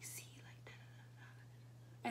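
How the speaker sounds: hushed whisper of the men; mimicking strangers whispering while they stare; whispered gossip. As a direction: whisper it like men plotting in secret. Men whispering about her.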